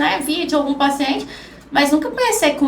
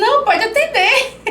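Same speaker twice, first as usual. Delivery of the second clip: fala aguda entre risos; paciente imitado, rindo; 8 semitones higher than usual. Retold or imitated. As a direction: imite o paciente cedendo depressa, achando graça. Paciente cede a vez, contado rindo. (paciente cedendo a vez, com riso)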